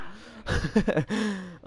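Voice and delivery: exasperated laugh. Real, not acted. Laughing after losing.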